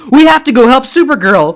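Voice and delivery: high, loud heroic Supergirl voice; a superheroine calling out in alarm; high heroine voice. Acted.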